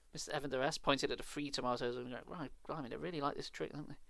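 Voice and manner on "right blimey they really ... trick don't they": humoring. A narrator's reading. Wife humoring husband.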